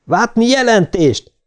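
high, urgent commander's call; insistent demand. A narrator's reading. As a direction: press a missing crewmate to report. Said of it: "ismételte meg Louise". (magas hangú, sürgető követelés)

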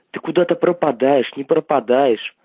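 ordinary delivery

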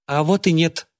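put-on high girl's voice, contradicting; Джорджия. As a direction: pipe up as a young girl. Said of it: "прозвучал девчоночий голос".

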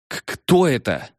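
scared stammer, high; stuttering fear. Acted. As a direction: stammer it out in fright. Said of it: "временное заикание".

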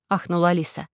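usual speaking voice

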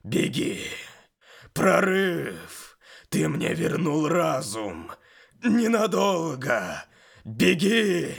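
loud, high, slow demon shout; a demon straining to warn. Acted. Demon warns, fighting control. (кричащий демон)

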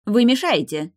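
loud high snapped rebuke; irritated snap. A narrator's reading. Doctor snapping at the hovering husband. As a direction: brush him off sharply. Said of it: "бросил лекарь".

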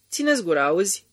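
furious yell. A narrator's reading. Husband yelling at his hysterical wife, loud and furious. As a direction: roar at her to shut up. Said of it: "urlă furios bărbatul".

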